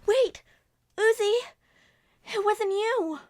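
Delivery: high, loud cry to stop her; loud, high, desperate call; urgent shout. Acted. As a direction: yell to stop her before it's too late. Stopping Uzi from banishing herself.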